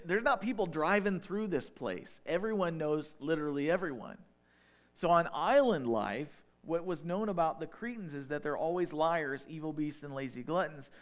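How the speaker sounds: ordinary delivery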